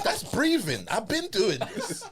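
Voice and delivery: high, laughing incredulity; laughing disbelief. Retold or imitated. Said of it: "You're like".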